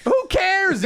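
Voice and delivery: high-pitched shouted protest; exasperated yell. Acted. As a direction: cut in yelling, fed up. Heckling the man in the story.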